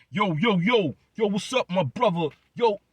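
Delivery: mock loud, high street greeting; ignorant bastard. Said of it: "start sounding like a ignorant bastard".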